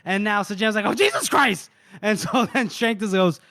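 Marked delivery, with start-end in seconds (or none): 0.8-1.7 mock pained outcry. Storyteller acting out the wounded man, mock pained cry. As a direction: exclaim as if jabbed, hammed-up. Acts out injured man's outcry.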